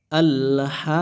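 "আল হা" with "হা" drawn out long; madd elongation. Shown as a demonstration. Teacher demonstrates reading with a pull, "হা" held long. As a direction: sustain the vowel of "হা" in a level chant. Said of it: "টেনে পড়তে হয়".

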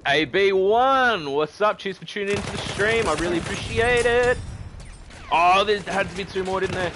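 high, loud hollered thanks; excited shout-out. Real, not acted. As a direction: holler it to a new viewer over the action. Calls out new subscriber while attacked.

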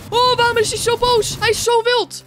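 high, loud alarmed shrieking. Real, not acted. Player shocked as the angry monster charges, high shriek.

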